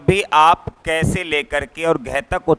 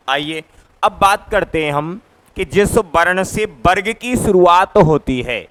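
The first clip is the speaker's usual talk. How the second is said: ordinary talk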